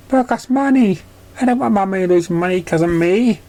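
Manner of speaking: put-on high, childlike and hurt; high young filly voice, a bit sulky; little filly voice. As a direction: play the small filly telling on a bully.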